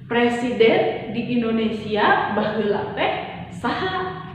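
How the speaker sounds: teacher demonstrating the question; question word last. Shown as a demonstration.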